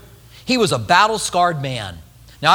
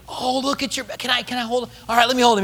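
eager dad; 5 semitones higher, faster than usual. Acted. Dad eager to hold baby.

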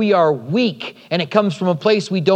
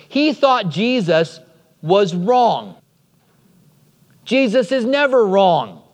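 normal talking voice

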